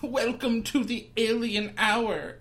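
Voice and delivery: mock alien voice. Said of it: "I am Glick Glock".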